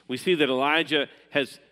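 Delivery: normal talking voice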